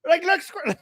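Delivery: high, loud mock shout of squirrel; mock squirrel distraction. Regime distracting the public, high mock squirrel shout. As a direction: yell it like spotting a squirrel, hammed-up. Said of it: "I think it's like".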